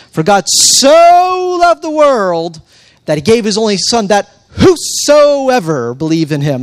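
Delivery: loud, high, long-drawn SO, then a punchy whosoever; hammed-up verse reading that stretches "so" way out and leans on "whosoever"; milk the words "so" and "whosoever" for full effect; exaggerated proper inflections. Shown as a demonstration. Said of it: "give you the, the proper inflections".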